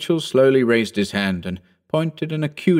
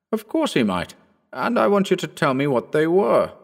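put-on a lighter, higher young gentleman's voice; young Phillipson; 8 semitones higher than usual. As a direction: lift the voice into the eager young squire. Phillipson presses Mitchell.

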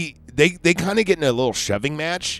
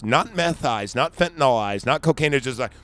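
ordinary talk, nothing marked

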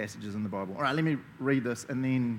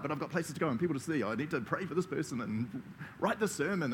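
rushed. Pretend. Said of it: "And I'm like".

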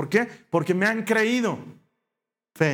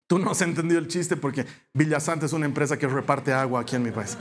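ordinary talk, nothing marked

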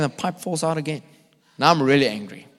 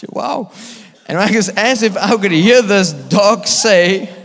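laughing; 6 semitones higher, 9 dB louder, slower than usual. Real, not acted. Laughing at his own dog story.

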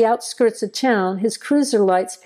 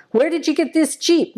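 a firm, higher demand; stern question; 7 semitones higher than usual. Acted. Deputy questioning the boy about the Jeep, stern. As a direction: press the boy for an answer. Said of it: "Maynard finally asked sternly".

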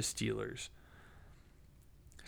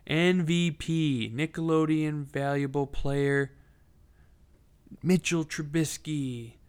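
mock announcer; 4 semitones higher, 4 dB louder, slower than usual.

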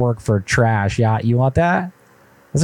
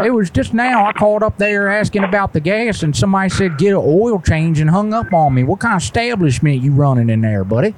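higher, indignant put-on caller voice; prank caller voice; 8 semitones higher than usual. Prank caller to the gas station, put-on higher indignant voice. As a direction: play a fussy customer who got hung up on.

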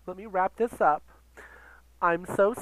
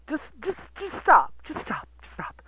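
fading plea; 4 semitones higher, 4 dB quieter than usual. Real, not acted. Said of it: "pleading stop repeated quieter".

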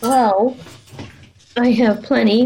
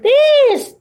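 word pushed up high in imitation; high-pitched imitation. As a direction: mimic a high squeaky pitch. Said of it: "that's like a high-pitched voice".

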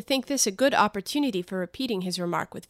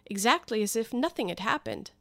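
ordinary delivery

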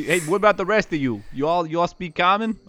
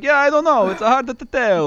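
much higher, louder put-on voice; Bernardo in character; 10 semitones higher, 4 dB louder than usual. Game master as Bernardo answers the others, high put-on voice. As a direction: pitch it up, slowing at the end.

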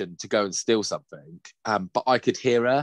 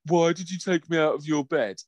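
put-on whiny child; 7 semitones higher than usual. Son complaining about being moved.

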